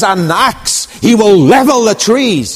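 high and loud, hammered proclamation; fiery prophet. Acted. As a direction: swing the words like an axe. John the Baptist proclaiming.

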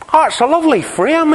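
high, naive admiring answer; wee Mark's voice. Retold or imitated. Mark answers about the painting.

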